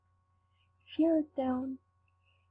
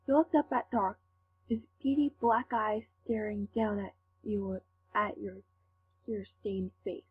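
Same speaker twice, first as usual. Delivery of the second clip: ordinary delivery, nothing marked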